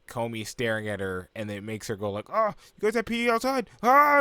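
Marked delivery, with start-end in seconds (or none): 2.3-4.2 high, loud mock blurt ending in a squeal; imitation of a flustered girl's outburst; panicking. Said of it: "she's panicking".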